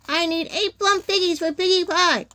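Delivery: high, forceful witch demand; screamed threat. A narrator's reading. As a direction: threaten them crossly. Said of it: "screamed Gritch".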